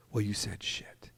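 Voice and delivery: slow hushed whisper. Retold or imitated. Producer quietly naming the swear word in a whisper. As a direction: breathe it out slow and discreet.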